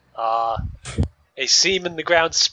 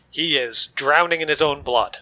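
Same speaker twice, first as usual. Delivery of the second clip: ordinary talk, nothing marked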